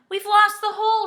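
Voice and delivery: high, loud, alarmed shout. A narrator's reading. Joe tumbles away from the ladder, shouting. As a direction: cry it out in panic. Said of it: "shouted Joe".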